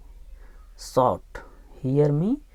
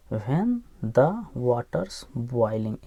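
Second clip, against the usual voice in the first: very slow, each word of This and this is my spot drawn out; slow reading model; 2 semitones lower, much slower than usual. Shown as a demonstration. Teacher reading the line slowly to students. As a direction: read it word by word for the class. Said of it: "teacher models the phrase slowly".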